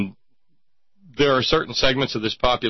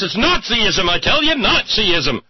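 mock outrage; 7 semitones higher, 4 dB louder than usual. Hammed-up mock of guest.

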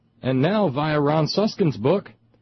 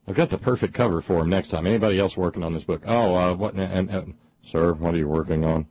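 acted investigator; 6 semitones lower than usual. Acts out an interrogator's role.

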